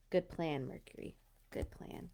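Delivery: drops to a whisper; hushed aside. Real, not acted. Reader quietly cheers on the story's cat, whispered. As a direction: murmur it softly to yourself, almost unvoiced.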